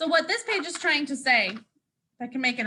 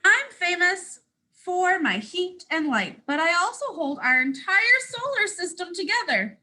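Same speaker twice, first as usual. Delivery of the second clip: lively storybook read as the boastful sun; reading to children; 4 semitones higher than usual. Acted. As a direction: proudly tell it as the sun to little listeners. Sun's story continued.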